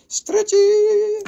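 high squeaky "stretchy", stretched out. Shown as a demonstration. Seller showing stretchy bracelet, high squeaky voice.

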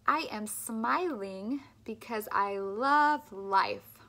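"love life" with slow, clear L; slow, careful speech-therapy model; slow clear L drill. Shown as a demonstration. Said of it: "Keep thinking about that tongue".

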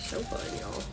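breathy whisper of delight. Real, not acted. Crafter whispers to her livestream viewers, delighted whisper. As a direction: whisper it to yourself, thrilled. Gushing over foils on livestream.

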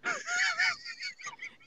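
very high breathy giggle; high-pitched laughing squeak; high squealing laugh. Real, not acted. Laugh after the proclamation.

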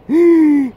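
sharp, high, loud theatrical gasp; mock gasp. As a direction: gasp as if shocked by your own words. Mock shock at own statement.

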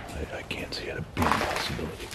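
hushed whisper. Real, not acted. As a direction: whisper it, uneasy. Exploring creepy house.

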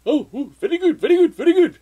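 very high, loud mock excitement; hammed-up, excited little voice; delighted store clerk imitation. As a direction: squeal approval like an impressed clerk. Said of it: "looked at her, and he's like".

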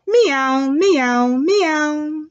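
three imitated meows in a row; sing-song teacher's kitty cries; cat meows. Shown as a demonstration. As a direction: meow three times like a cat. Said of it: "And they say what?".

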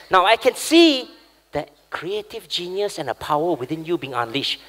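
usual speaking voice

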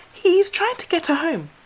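high, quick squirrel. Acted. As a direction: rush it out like a squirrel. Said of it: "said Squirrel quickly".

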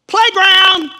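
high, loud scornful cry of playground; mock shout of playground. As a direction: fling the word out like a taunt. Mocking petty online fights.

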